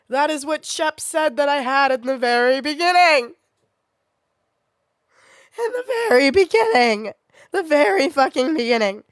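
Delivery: high, loud, rising indignation; worked-up, high-pitched yelling; exasperated outburst. Real, not acted. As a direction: hit each word loudly in outrage. Outraged at missed early diagnosis.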